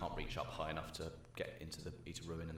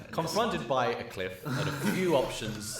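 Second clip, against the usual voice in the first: louder, higher, breaking into laughter; laughing narration; 7 semitones higher, 9 dB louder, slower than usual. A narrator's reading. Game master teasing the party as it breaks down, laughing narration. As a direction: narrate grandly but slowly through laughs.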